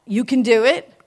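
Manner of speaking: loud encouraging call; inner cheerleader. Pretend. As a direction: shout encouragement. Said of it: "what does the inner cheerleader say".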